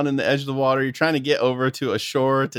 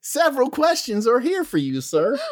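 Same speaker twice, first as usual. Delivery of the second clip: high folksy guard voice; Southern guard; 10 semitones higher than usual. Acted. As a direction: question strangers like a suspicious Southern sentry. Guard questions.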